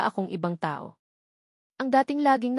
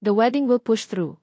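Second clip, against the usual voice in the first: firm reply; 3 dB louder than usual. A narrator's reading. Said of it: "mariing sagot ni Gabriel".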